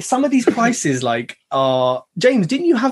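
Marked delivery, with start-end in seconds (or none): none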